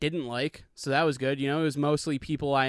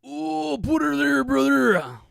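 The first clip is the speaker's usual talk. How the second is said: much higher, slow hammed-up wrestler voice; Hulk Hogan impression; 11 semitones higher, 6 dB louder, slower than usual. Vlogger trying the Hulk Hogan voice once more, much higher and slower. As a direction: stretch it out like a cartoon Hulk Hogan. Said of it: "It's not that good either".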